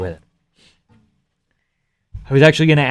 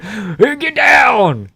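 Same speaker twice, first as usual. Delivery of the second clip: higher mock shout; retold shout; 11 semitones higher, much slower than usual. Cop warns of gunfire, mock shouting. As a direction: yell a warning, mock panic. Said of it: "he said".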